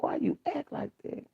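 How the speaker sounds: high, breathy whispered scold; hushed scold. Retold or imitated. Mother quietly scolding her daughter, high whisper.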